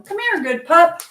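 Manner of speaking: high-pitched praise for a pup; calling a dog. Real, not acted. Owner praising the good pup, high-pitched dog talk.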